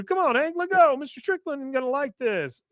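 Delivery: high squeaky pleading. Retold or imitated. Rich begging the guys while Hank drags him, high pleading squeal. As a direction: voice jumps way up, pleading to be let go.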